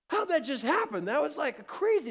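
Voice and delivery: high, loud amazed outburst; stunned disbelief. Retold or imitated. Dad turns to son after rescue, stunned. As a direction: retell it as a baffled, astonished exclamation.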